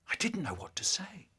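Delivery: whispered guilty admission; mild guilty whisper. A narrator's reading. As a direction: hush it as if ashamed. Guilty child at a loss.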